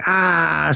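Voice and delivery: high mock scream at talking volume; retold shriek, held back; imitated scream. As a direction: let out a half-hearted high "ah". Retold scream as attacker appears.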